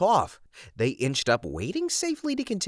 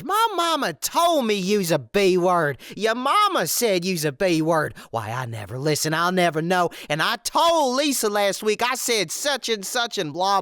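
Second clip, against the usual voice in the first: ranting wife; 6 semitones higher than usual. Retold or imitated. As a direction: drag out the insults like a spiteful wife. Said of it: "bullcrap avalanche".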